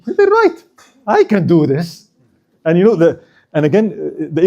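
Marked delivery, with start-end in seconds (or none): 0.1-2.1 high-pitched moment of persuading oneself; lightly acted self-realization. Queen realizing she could take the throne, self-convinced. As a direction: lightly voice her talking herself into it. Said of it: "she saw in herself".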